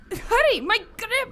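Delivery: high, straining, losing her hold; strained urgency. Acted. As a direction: Manjula losing her grip on Leopold, high strained plea.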